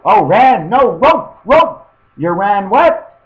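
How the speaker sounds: loud, high-pitched misunderstanding on the phone; confused shouting. Acted. Acted misheard phone conversation.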